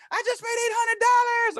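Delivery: woman running out, high and loud with joy; excited yell. Retold or imitated. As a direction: burst out with the news. Tam runs out excited.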